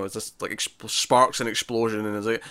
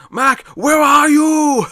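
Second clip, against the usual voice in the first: very high, loud, drawn-out Arnie yell into the jungle; Arnold shout; 9 dB louder, much slower than usual. Retold or imitated. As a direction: call for Mac like Arnold, stretching every word. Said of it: "his accent really shines, it's proper Arnie".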